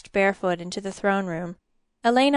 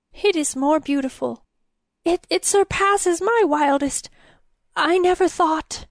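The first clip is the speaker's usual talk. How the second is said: stammering; 7 semitones higher than usual. A narrator's reading. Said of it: "He stammered into silence".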